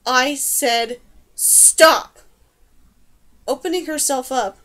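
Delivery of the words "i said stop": slow, loud, deliberate command; forceful cry. A narrator's reading. Dane to rats staring at her, slow forceful shout. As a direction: space out each word, hammering the last. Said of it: "Dane cried".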